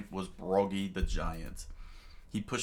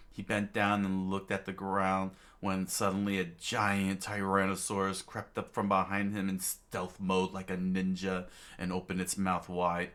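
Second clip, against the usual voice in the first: ordinary delivery, nothing marked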